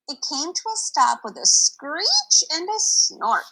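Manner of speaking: ordinary delivery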